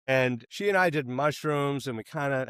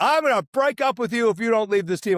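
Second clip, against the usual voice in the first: high, loud, rushed retold threat; angry ultimatum; 7 semitones higher, 5 dB louder, faster than usual. Girlfriend threatening to break up over rugby, retold anger. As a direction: imitate her fast, heated ultimatum.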